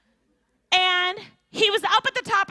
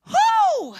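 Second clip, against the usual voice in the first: whoo; 6 dB louder than usual. Retold or imitated. Overwhelmed mother exclaiming.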